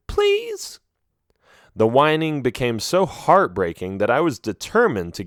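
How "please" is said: very high, slow whimper. A narrator's reading. Trapped sheep begging in the dark, whimpering. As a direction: beg weakly, close to tears. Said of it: "she whimpered".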